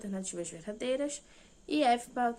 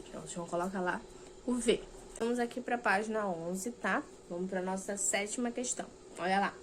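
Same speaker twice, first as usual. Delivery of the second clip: usual speaking voice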